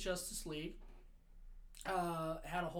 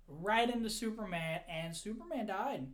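usual speaking voice

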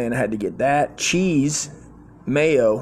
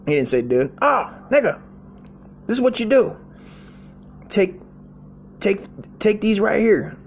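much higher, loud put-on boss voice; old boss voice; 9 semitones higher, 3 dB louder than usual. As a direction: play the crusty vet handing over pills. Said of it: "He's like".

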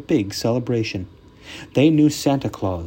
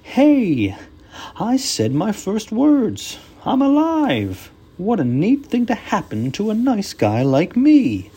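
high, loud cartoon snowman; jolly snowman voice; 10 semitones higher, 4 dB louder than usual. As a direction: delight like a newborn snowman. Frosty awakened.

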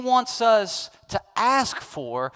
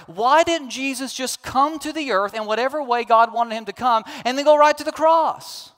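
loud, raised rhetorical questions to the congregation; preaching at full force; 7 semitones higher, 6 dB louder than usual. Acted. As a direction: press the questions hard and high, like a preacher building.

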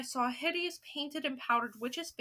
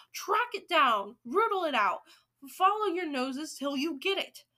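louder, driving orders; screamed command; 3 semitones higher, 5 dB louder than usual. Acted. Grand High Witch commanding her witches, crying orders. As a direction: urge on the hunt in a raised voice. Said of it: "screamed the Grand High Witch".

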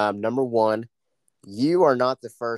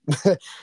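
quick rising laugh, pitched up; bright laugh; 7 semitones higher than usual. Real, not acted. Laughing at the teacher's praise of her speech, bright quick laugh. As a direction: laugh it off, delighted.

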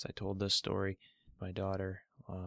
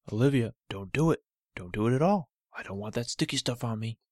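louder, grumpy little toe voice; a cranky toe refusing the Band-Aid; stubborn talking toe; 2 semitones higher, 6 dB louder, faster than usual. Acted. Cut toe protesting to Olivia, loud and grumpy.

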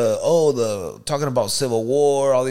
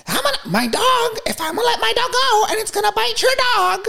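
very high, squealed mock threat; hammed-up shrieking dog owner; threatening woman imitation. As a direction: screech a warning like a frantic lady.

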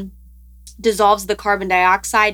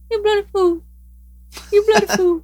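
high squawky talking-duck voice, pitched way up; imitating the duck; 12 semitones higher, faster than usual. Shown as a demonstration. Imitating the talking duck for her co-host. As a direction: mimic a parrot-like duck squawking the phrase. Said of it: "let me see if I can imitate".

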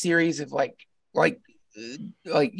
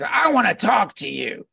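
a touch of mock sternness, a little higher; imitating a teacher pointing a finger; mock fierce; 6 semitones higher, 4 dB louder than usual. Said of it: "he could do fake fierce really well".

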